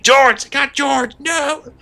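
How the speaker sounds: high, slow mock English soldier wail; panicked soldier. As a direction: cry out for a fallen comrade. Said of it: "they think".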